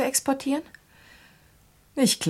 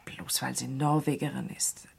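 softer, barely voiced, defending Gerda; hushed whisper; 8 semitones lower, 8 dB quieter than usual. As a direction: whisper it, shaken and protesting. Hushed worry over the accusation.

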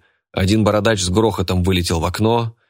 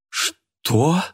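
sudden outburst; 8 semitones higher than usual. A narrator's reading. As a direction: burst out in disbelief and outrage. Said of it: "взорвалась вдруг она". (возмущённый взвизг)

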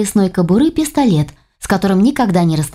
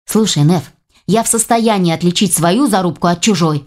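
plain reading voice

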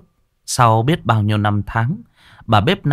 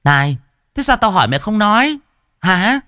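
shouting demand; 12 semitones higher than usual. A narrator's reading. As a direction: bark for an answer. Mistress demanding an answer.

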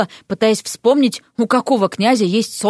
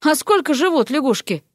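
mildly musing, higher-pitched question; pensive question; 5 semitones higher than usual. A narrator's reading. Young man wonders about his frog bride, pensive. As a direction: wonder aloud. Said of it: "задумчиво спросил".

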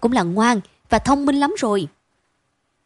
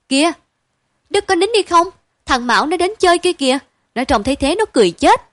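1 coaxing big sister; 7 semitones higher, 5 dB louder, faster than usual. A narrator's reading. Sister coaxing crying brother.

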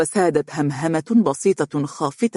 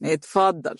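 bored. A narrator's reading. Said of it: "قال الرجل بملل".

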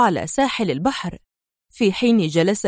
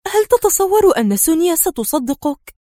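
higher, nervous question; tense; 8 semitones higher than usual. A narrator's reading. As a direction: ask with nerves, doubting. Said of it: "سألته في توتر".

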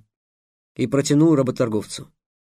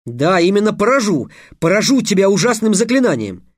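high, loud, declamatory threat; emphatic threat; 7 semitones higher, 5 dB louder than usual. A narrator's reading. Trix threatening the trader with a spell, emphatic. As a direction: land «поражу» firmly, as a vow. Boy wizard confirms his threat.

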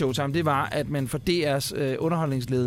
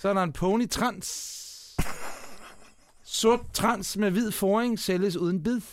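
higher-pitched Bornholm dialect, slowing down; Bornholm accent; 4 semitones higher than usual. Shown as a demonstration. Reading a pony ad to a co-host, Bornholm accent. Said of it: "læse lidt op med en lille bornholmsk accent".

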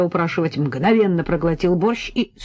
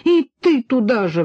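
higher, exasperated reproach; indignant; 6 semitones higher, 4 dB louder than usual. A narrator's reading. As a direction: round on an ally, mildly outraged. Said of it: "возмутилась Надя". (возмущённо)

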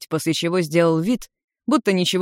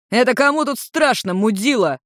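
high-pitched indignant outburst; offended, heated retort; enraged; 6 semitones higher than usual. A narrator's reading. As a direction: flare up at the accusation. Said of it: "рассвирепел Улмар".

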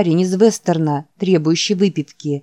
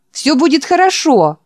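high, loud rapturous cry; ecstatic cry; 9 semitones higher, 4 dB louder than usual. Acted. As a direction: squeal with joy. Old man rapturous. (восторженный вопль)

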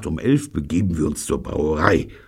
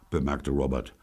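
ordinary delivery

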